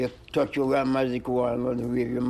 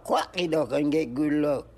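ordinary talk, nothing marked

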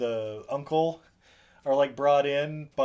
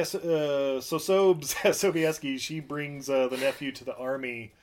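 ordinary delivery

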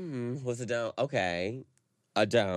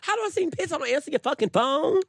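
shrill indignant protest; 5 dB louder than usual. Real, not acted. Indignant outburst in argument.

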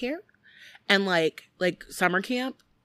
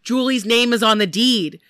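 alarmed realization; 4 semitones higher, 7 dB louder than usual. Retold or imitated. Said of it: "he's like".

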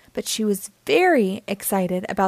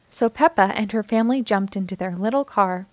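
plain reading voice

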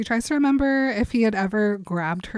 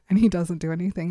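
ordinary talk, nothing marked

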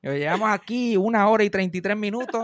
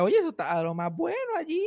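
mock grandma; 10 semitones higher than usual. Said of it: "cuando vira para atrás".

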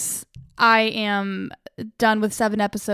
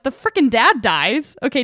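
ordinary talk, nothing marked

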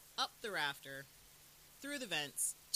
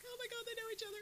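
high, soft squeal of delight; thrilled fan; 5 dB quieter, faster than usual. Retold or imitated. Fan finding out two characters know each other, mock thrilled. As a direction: gush in a small high voice, imitated. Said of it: "you're like".

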